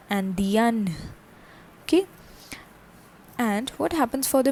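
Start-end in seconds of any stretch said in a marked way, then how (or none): 0.1-0.9 "Andien" as an-dyen, final n sounded; feminine French pronunciation. Shown as a demonstration. Teacher showing the feminine change, "Andien" ending in a sounded n. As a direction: close "Andien" on a clear n. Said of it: "the pronunciation changes and becomes".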